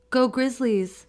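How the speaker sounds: high, loud teacher's cheer; rally the class with pep; rallying school cheer. A narrator's reading.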